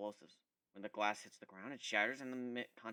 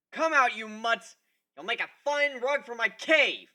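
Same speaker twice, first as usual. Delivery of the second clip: gruff shout; 10 semitones higher, 12 dB louder than usual. Acted. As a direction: roar it at them like a brute. Villain shouting at the dogs.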